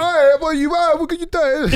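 loud, high-pitched mock British yell; imitating a terrible British actor at full volume; bad British accent. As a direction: wildly overdo a fake British accent. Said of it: "it was really bad".

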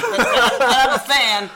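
loud, high-pitched mock cheer; fan shout. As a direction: belt it out like a rowdy fan. Acting out defiant fan cheer.

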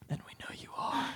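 whispered teasing aside; hushed, knowing little aside; conspiratorial whisper. Pretend. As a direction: lean in and whisper it to the listener. Teasing aside to absent listener.

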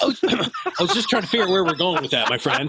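talking through real laughter, pitch jumping up; amused, can barely get the words out; laughing. Real, not acted. Laughs at the joke.

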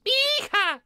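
put-on high shrieked old woman; old screaming lady. Mimicking the film's screaming old lady. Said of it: "she's like".

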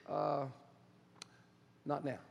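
low, soft, final no from God; God's quiet answer. Acted. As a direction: let the refusal settle gently and low.

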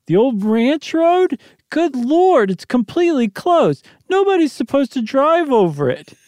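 high, fretful housewife voice; a frightened wife pleading; anxious wife voice. Acted. Janet's voice in reading.

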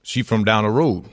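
put-on folksy Louisiana Southern accent. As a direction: answer like a Louisiana local. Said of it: "He's doing a Southern accent".

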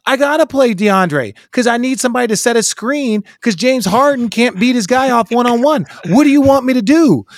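exasperated high-pitched coach; whiny coach pleading his case; coach impression. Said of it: "He was like".

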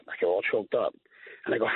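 usual speaking voice

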